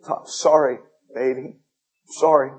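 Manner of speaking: ordinary talk, nothing marked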